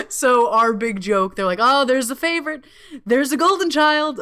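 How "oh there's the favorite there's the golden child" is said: mock family teasing. Family teasing her in singsong.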